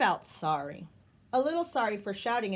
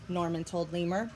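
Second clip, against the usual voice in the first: ordinary delivery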